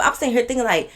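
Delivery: ordinary talk, nothing marked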